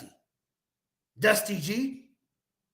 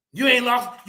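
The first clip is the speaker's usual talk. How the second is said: ordinary talk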